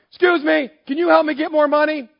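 high, loud cheeky request; brash money-grabber. Acted. A greedy man interrupts Jesus mid-breath, high and loud. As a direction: barge in, asking for cash. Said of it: "Not this guy".

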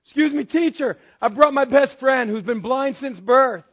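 high, loud urgent plea for a blind friend; polite interrupter. Pretend. A man calls out to the teacher for his friend, high and loud. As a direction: call out over the crowd to the teacher. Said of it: "worthy of interrupting Jesus".

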